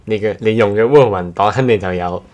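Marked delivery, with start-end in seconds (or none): none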